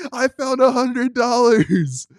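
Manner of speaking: high, loud, slow proclamation; excited kid shout. Retold or imitated. Boy bursting in to tell his mom. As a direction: burst in announcing it.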